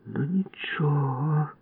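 slow whispered hiss; hissed threat. Acted. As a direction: menace under breath. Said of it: "просичала Валька". (просичала)

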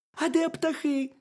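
high, squeaky little hedgehog; very high, loud cartoon critter voice. A narrator's reading. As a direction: pipe it up as a tiny curious hedgehog. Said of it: "запитав їжачок".

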